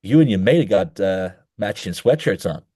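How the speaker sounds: loud, attempted American accent at the start; put-on American or Canadian accent; North American accent. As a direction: imitate an American or Canadian, best effort. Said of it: "that's my best American or Canadian accent".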